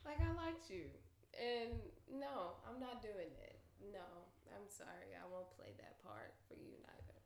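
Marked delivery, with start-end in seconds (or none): 2.1-7.2 soft whispered no; a soft, breathy refusal. Real, not acted. Quiet refusal aside.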